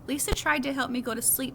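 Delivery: plain reading voice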